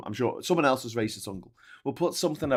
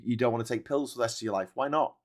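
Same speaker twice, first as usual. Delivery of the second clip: ordinary talk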